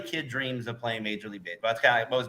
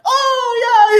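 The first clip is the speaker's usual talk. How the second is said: much higher, louder shout; mock runner exclaiming; 12 dB louder than usual. Host imitating the runner's surprised reaction, loud high shout. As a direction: yelp it out high like a caught runner.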